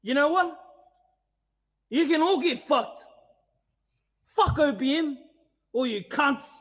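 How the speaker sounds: storming out of the bar, shouting at the whole team; angry outburst. Acted. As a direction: yell it at all of them as you storm out.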